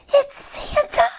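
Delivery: high, breathless excited cry; delighted gasp. Acted. Children spotting Santa, high gasping delight. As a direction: squeal with hushed delight. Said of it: "we whispered with gasps of delight".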